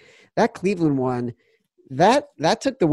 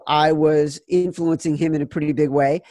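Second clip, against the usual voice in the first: usual speaking voice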